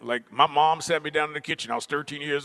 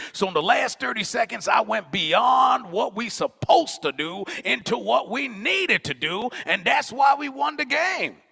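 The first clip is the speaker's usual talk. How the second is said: lecturing coach; 8 semitones higher than usual. Retold or imitated. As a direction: drive the lesson home, stretching the key words. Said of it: "he was just like".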